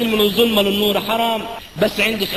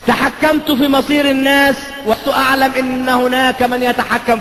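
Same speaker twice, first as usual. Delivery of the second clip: slow, higher and louder, declaiming each clause; solemn declaimed confession; 7 semitones higher, 4 dB louder than usual. Acted. Preacher reading a priest's confession aloud, slow solemn declamation. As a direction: proclaim it like a public confession.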